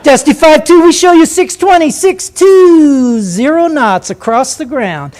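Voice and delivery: high, loud sing-song radio reply, drawn out at the end; gleeful controller voice. Retold or imitated. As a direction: announce the speed with triumphant glee. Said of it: "a little glee in the controller's voice".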